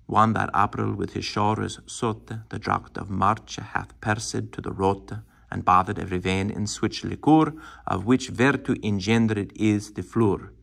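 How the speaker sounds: put-on Chaucer's old vowels, Middle English recitation. Shown as a demonstration. Teacher reciting Geoffrey Chaucer for a class, in Middle English accent. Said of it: "this is Middle English".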